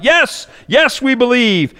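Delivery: high mock cheer; crowd shout. Crowd shouting back to Blondin, high mock cheer. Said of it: "And they shout".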